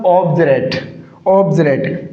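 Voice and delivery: ऑब्जरेट with a "j" in place of d, each time falling; corrected pronunciation. Shown as a demonstration. Teacher drilling the correction for students, ऑब्जरेट with a "j" sound. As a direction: say ऑब्जरेट as "ob-je-rate", falling at the end. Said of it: "इसका उच्चारण जय होगा".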